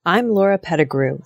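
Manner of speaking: ordinary talk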